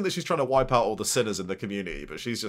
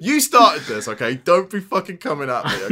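much louder and higher, playfully indignant; mock outrage; 7 semitones higher, 10 dB louder than usual. Real, not acted. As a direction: snap back at your co-host in jest. Mock defensive outburst.

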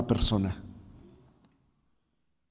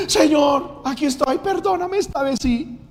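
clamor imitado; 11 semitones higher than usual. Retold or imitated. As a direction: cry out as an imitated returning backslider. Mimics backslider crying out again. (clamor agudo y fuerte, imitado)

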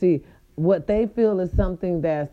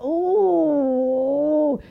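Kim K ooh; 8 semitones higher, 4 dB louder than usual. Retold or imitated. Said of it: "In her Kim K voice".

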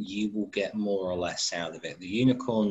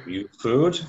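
louder frog question; 3 semitones higher, 8 dB louder than usual. Acted. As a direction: dim frog asking. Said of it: "Frog impression".

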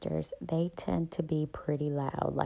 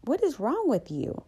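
mother's retort; 7 semitones higher than usual. Retold or imitated. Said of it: "she's like".

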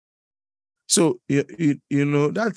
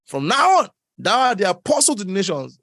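high, loud heavenly proclamation; hammed-up angelic decree, higher and louder; mock angel voice; 7 semitones higher, 5 dB louder than usual. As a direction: proclaim like a seraph commissioning. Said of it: "and said".

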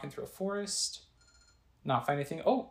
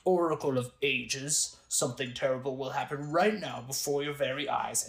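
villain voice. Acted.

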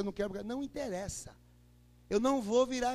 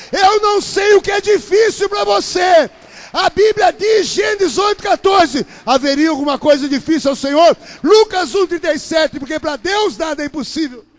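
loud, high sermon voice; roaring scripture proclamation; full-force preaching; 6 semitones higher, 21 dB louder than usual. Real, not acted. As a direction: hammer the verses home.

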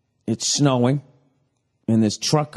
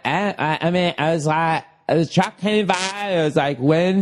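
mock flustered woman; 5 semitones higher than usual. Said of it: "She was going like".

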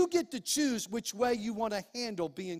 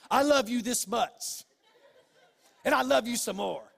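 laughing joke. Real, not acted. Preacher joking to congregation about loving kids, laughing. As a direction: chuckle through it. Jokes he loves them this much.

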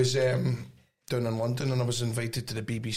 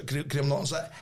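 ordinary talk

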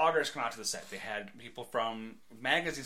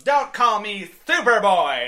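slow, far louder and higher yell; Superboy yell; 8 semitones higher, 15 dB louder, slower than usual. Retold or imitated. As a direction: throw your head back and yell it. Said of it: "he goes".

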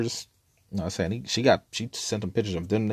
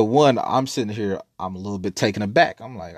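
normal talking voice

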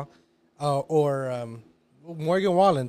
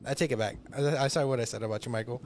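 ordinary talk

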